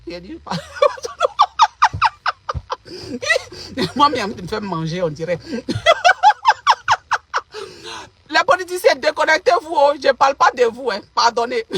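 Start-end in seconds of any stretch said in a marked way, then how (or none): 0.5-3.9 shrieking high burst of laughter. Real, not acted. Laughing at her own joke, high shriek. 5.4-8.0 high laugh. Real, not acted. Laughing at own joke.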